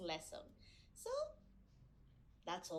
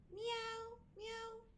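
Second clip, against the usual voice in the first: high, soft kitty meows; high meowing, softer on the second; cat meow; 9 semitones higher, 5 dB quieter than usual. Shown as a demonstration. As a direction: mew like a little cat. Teacher imitates the cat.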